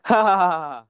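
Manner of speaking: loud, high staged ha-ha-ha; exaggerated fake laughter; mock laugh. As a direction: put on a big laugh for comic effect. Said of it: "Laughing effect imbued".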